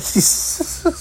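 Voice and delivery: high airless wheeze of a laugh; breathy laugh. Real, not acted. Laughing at the toilet joke, high wheeze. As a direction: let out a silent high wheeze.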